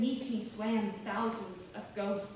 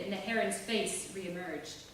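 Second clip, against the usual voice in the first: ordinary delivery, nothing marked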